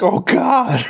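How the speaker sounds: high-pitched, hammed-up dismay; mock horror. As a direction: imitate a victim suddenly horrified, pitch jumping up. Imagined victim reacting to monster.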